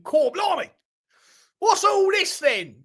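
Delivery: high, hammed-up fake English accent; shrieky caricature of an English accent, very high; Yank doing English accent. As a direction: mimic an American's awful Cockney. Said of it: "Hearing Yanks doing English accents crack me up".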